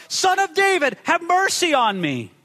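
high, insistent plea; urgent cry falling at the end. A narrator's reading. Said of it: "cried out all the more".

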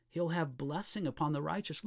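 normal talking voice

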